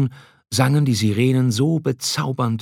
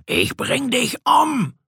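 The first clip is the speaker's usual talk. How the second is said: slow, much higher murderous scream; Odysseus to his loyal comrade, raging and much higher; furious shout; slower than usual. A narrator's reading. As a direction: threaten him with wild rage. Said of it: "schrie Odysseus".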